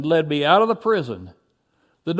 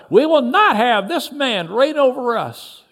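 high, raised protest; shout; 5 semitones higher, 4 dB louder than usual. A narrator's reading. Someone in the angry crowd rejects Jesus, shouting. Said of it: "Someone shouted".

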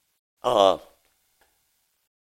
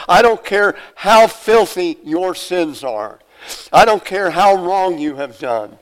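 raised, emphatic preaching; preaching at full force; 4 semitones higher, 4 dB louder than usual. Real, not acted. Preacher telling sinners God welcomes jerks, emphatic. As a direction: drive it home from the pulpit.

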